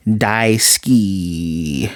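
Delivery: slow, stretched-out daisuki, each syllable held; gushing, drawn out. Retold or imitated. Reviewer voices a lovestruck thought in Japanese, gushing slowly. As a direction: linger long on "daisuki". Swooning love word, drawn out.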